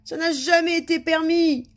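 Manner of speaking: high, loud whining wail; snivelling. A narrator's reading. King adds his complaint, snivelling. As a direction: whine it like a crybaby. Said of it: "ajouta-t-il en pleurnichant".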